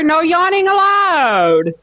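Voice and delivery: high and slow with "allowed" stretched out long; mock stern sing-song. Teasing friends who yawn, high slow mock-stern scold. Repeats rule to yawning friends.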